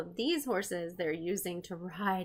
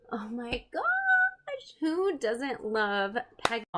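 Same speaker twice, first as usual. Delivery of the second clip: excited gasp; 3 semitones higher, 5 dB louder, slower than usual. Real, not acted. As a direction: squeal over the flying horse. Excited about Pegasus.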